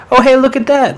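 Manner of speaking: high-pitched mock discovery; feigned innocent surprise. Boy re-enacts noticing his untied shoelaces, feigned surprise. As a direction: play innocent, far too casually surprised.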